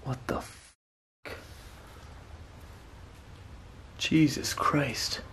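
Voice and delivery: breathless, hushed disbelief; shocked whisper. Real, not acted. Seeing the scratch on his neck, hushed shocked whisper. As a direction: barely get the words out, stunned.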